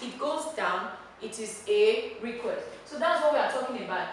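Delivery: ordinary talk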